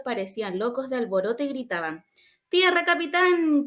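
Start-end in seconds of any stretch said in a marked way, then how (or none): 2.5-3.7 high, slow shrieked cry of land. Acted. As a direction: shriek it to the captain, drawn out. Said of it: "chilló Gómez otra vez".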